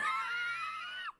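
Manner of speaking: a very high squealing laugh; shrill laugh burst. Real, not acted. Cracking up at the stabbing, high squeal.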